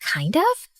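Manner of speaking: rising, teasing echo of "kind of"; mock offended. Girl teasing Lewis about his compliment, rising echo. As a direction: throw "kind of" back at him playfully. She echoes his words.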